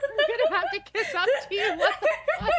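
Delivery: can barely talk for laughing; cracking up over the chat joke, laughing; laughing hard. Real, not acted.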